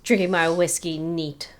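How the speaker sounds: lightly voiced hard-boiled detective; a hint of gumshoe voiceover; noir detective. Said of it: "grizzled detective".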